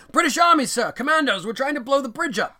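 high, loud urgent soldier; urgent commando report. Retold or imitated. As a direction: Joyce blurting it out to Nicholson. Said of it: "He's like".

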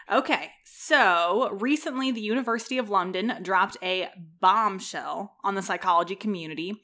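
ordinary talk

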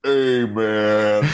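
imitating a slow, drawn-out nitrous voice. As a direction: put on a dopey gassed-up drawl, playfully. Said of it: "'Cause your voice goes deep".

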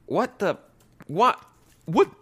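high, stunned what what what; real shock, climbing up; baffled repeated what; real disbelief. Real, not acted. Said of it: "we were getting upset".

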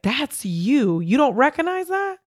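emphatic, swooping high at the end; therapist's incredulous challenge. Retold or imitated. Therapist challenging her client to see herself, incredulous. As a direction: push the question up in disbelief.

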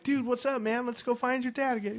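high, chummy hammed-up greeting; mock buddy greeting. To the lost kid again, high chummy mock greeting. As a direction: greet the kid like an old pal.

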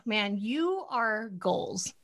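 ordinary talk, nothing marked

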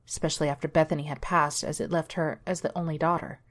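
ordinary delivery, nothing marked

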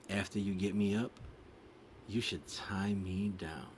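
slow, soft suggestive reading; sultry riddle read. Acted. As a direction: purr each clue out slowly. Reads sexy riddle clues.

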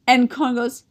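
ordinary delivery

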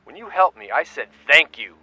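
shouting in rage; furious shout. Acted. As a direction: yell it at him. Greg yelling at Stanley.

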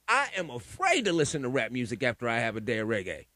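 ordinary talk, nothing marked

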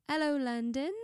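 put-on British greeting; British accent. Shown as a demonstration. Correcting friend who copies her, mock British accent. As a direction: say hello with a British lilt. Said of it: "do British".

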